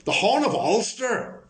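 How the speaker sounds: high, drawn-out mock politician voice; Gerry Adams impression. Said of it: "do my Gerry Adams impression".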